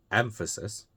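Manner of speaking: EM-phasis, first syllable of emphasis stressed. Shown as a demonstration. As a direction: hit the start of "emphasis", let the rest fall. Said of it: "put the stress on the first syllable".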